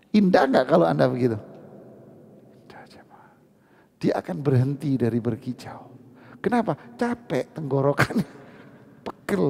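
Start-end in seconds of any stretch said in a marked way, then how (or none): 2.5-3.3 breathy whisper to the crowd. Real, not acted. Preacher whispering the answer to the congregation. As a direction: lean in and whisper it. Answering own question softly.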